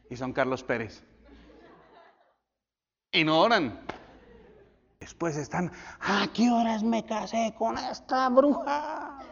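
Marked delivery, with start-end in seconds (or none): 6.0-9.2 higher, slow whiny complaint; bewildered husband, hammed-up; mock regretful husband. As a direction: whine like a husband stuck with his wife. Husband mocked regretting marriage.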